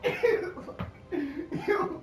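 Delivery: high, loud, slow words through laughter; laughing hard. Real, not acted. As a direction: talk while cracking up. Laughing hard while talking.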